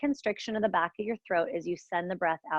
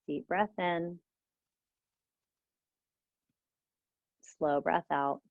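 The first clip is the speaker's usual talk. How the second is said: guided meditation; 2 semitones lower than usual. Acted. Leads a guided breathing meditation.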